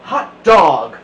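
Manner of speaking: loud joyful shout of hot dog; excited cry. A narrator's reading. Class cheering loudly at their teacher leaving. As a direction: cheer it out like a class set free. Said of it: "cried the class".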